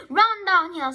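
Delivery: high, loud call; urgent suggestion. A narrator's reading. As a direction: call out advice across the farmyard. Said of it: "suggested the cows".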